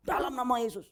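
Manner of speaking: rebuking the devil. Retold or imitated.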